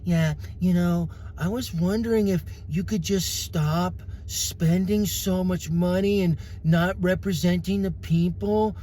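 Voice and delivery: lightly voiced timid request; a hint of a meek, overly polite caller. Preacher mocks a timid call to his senator, lightly voiced. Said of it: "I'm not gonna be like".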